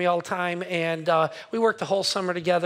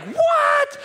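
much higher, louder startled squeak; shocked what; 5 dB louder than usual. Retold or imitated. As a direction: squeal the word in disbelief. Shock at dog hitting glass.

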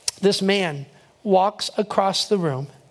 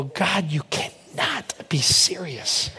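low, slow, drawn-out dismay; retold disbelief; 3 semitones lower, much slower than usual. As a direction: stretch it out in mock disbelief. Reacting in dismay to God's prompting.

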